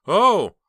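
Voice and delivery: sharp high startled cry; startled gasp. Acted. Heather startled by a bang at the window, gasping. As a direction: jump at a sudden bang.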